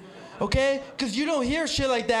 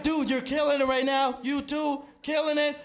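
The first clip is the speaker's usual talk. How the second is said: ordinary delivery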